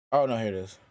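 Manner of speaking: ordinary talk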